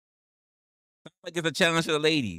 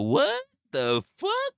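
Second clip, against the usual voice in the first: stunned disbelief; 4 dB louder, slower than usual. Real, not acted. Baffled by chat's question.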